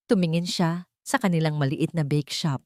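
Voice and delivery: usual speaking voice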